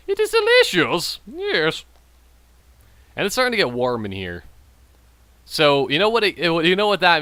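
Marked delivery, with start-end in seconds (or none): 0.1-1.9 very high, playful squeaky aside; silly falsetto. Pretend. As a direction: leap up into a comic falsetto. Same silly act, jumps to falsetto.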